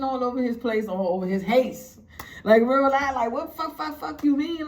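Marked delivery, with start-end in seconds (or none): 3.3-4.7 higher-pitched flustered stammer; imitating a rattled stutterer; nervous stutter. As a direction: stumble on the first words in agitation. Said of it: "he was stuttering and all that".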